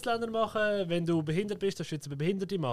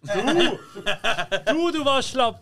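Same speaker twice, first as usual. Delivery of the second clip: loud laughing accusation; laughing shout; 5 semitones higher, 7 dB louder, much slower than usual. Real, not acted. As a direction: laugh it out loudly at him.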